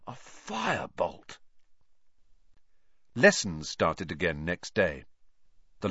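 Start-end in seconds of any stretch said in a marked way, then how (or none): 0.1-1.4 slow, soft and dreamy. A narrator's reading. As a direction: murmur it in longing. Wood trails off dreamily.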